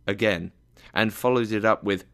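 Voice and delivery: ordinary delivery, nothing marked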